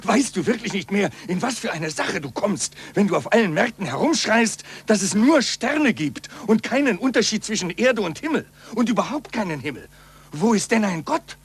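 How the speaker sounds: agitated, anxious appeal; alarmed pleading. Acted. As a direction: warn him in fear.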